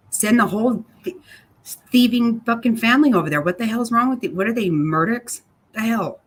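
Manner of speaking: real anger spilling over about the theft; real angry outburst. Real, not acted. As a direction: let the outrage burst out at them. Furious outburst about thieving family.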